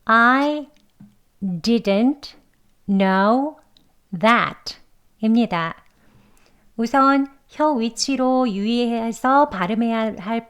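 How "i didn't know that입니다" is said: I, didn't, know, that each said alone with pauses; word by word. Shown as a demonstration. Teacher reads dictionary style, word by word. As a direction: spell out every word carefully. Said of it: "발음 기호 그대로 또박또박".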